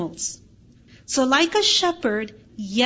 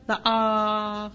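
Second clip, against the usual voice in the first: usual speaking voice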